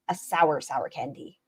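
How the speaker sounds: normal talking voice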